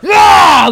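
loud high primal yell; primal scream. Retold or imitated. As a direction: scream like a caveman. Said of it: "screaming primally".

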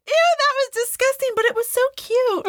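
put-on very high child voice, grossed out yet charmed; torn little boy. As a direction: squeak it like a kid who can't decide. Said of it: "Reagan kept going like".

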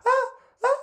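high startled screams with a laugh; waking screams. Retold or imitated. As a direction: scream awake, half laughing.

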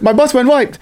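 squeaky, silly "butt's" line; high mock quote. Correcting the quote for a friend, high and mocking. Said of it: "Yeah, that's what it was".

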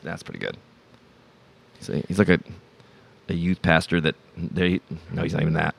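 ordinary delivery

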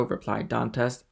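ordinary delivery

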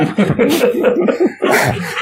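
a sudden high burst of laughter. Real, not acted. Host laughing at the singer's clip, high burst of laughter.

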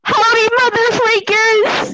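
loud, high cowboy greeting; yelling. Acted. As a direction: yell it out as Pete. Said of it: "Pete yells".

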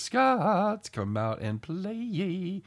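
high, slow, drawn-out taunting call; sing-song "come out and play" taunt; Warriors movie taunt. Retold or imitated. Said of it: "channeling his inner warrior".

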